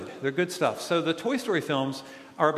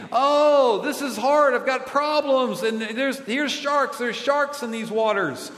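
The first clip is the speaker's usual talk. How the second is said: high, alarmed inner voice; worried inner voice; 6 semitones higher, slower than usual. Retold or imitated. Kids hear a traveler fretting at sharks, higher and alarmed. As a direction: panic a little at the sharks. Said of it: "and you think".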